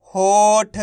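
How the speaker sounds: होठ with a long held "o" and light final ठ; half final letter. Shown as a demonstration. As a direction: draw out होठ for learners, halving the ठ. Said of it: "last वाले अक्षर का उच्चारण आधा किया जाता है". (होठ को खींचकर, आखिरी ठ आधा)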